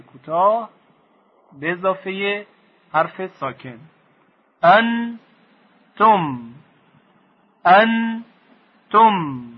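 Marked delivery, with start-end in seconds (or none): 4.6-9.4 «انتم» very slowly as «ان», pause, «تم»; high held «ان», then separate falling «تم» in «انتم»; syllable by syllable. Shown as a demonstration. Teacher showing the two parts of «انتم», syllable by syllable.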